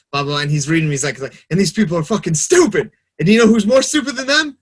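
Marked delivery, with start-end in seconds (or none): 1.5-4.6 high, loud exasperation building to a laugh; emotional comic rant. Retold or imitated. Retelling a comedian's bit on online comments, emotional mock outburst. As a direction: rant as a worked-up comedian, getting louder. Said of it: "he's getting emotional".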